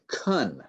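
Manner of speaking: can weakened to "kun" with a short uh; reduced can. Shown as a demonstration. Teacher showing students how can reduces, reduced vowel. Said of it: "Can changes to kun".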